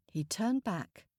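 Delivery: ordinary delivery, nothing marked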